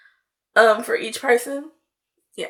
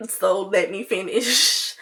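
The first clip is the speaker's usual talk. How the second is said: slow, at normal pitch, with "finish" stretched; mild bratty insistence; slower than usual. Retold or imitated. Bratty friend explaining herself, slow mildly insistent drawl. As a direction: drag out "finish" without raising the pitch. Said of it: "'Cause I'm annoying and I'm a brat".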